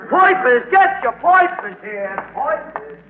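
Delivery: newsboy hawking papers; calling out. Acted. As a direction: shout your papers to the street.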